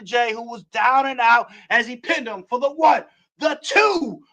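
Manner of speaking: excited wrestling play-by-play; play-by-play call. Acted. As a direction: call the match like a ringside announcer.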